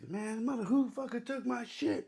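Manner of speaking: high-pitched imitation of a yelling instructor; mock angry yell. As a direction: act out an outraged yell without real volume. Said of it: "he's yelling at the".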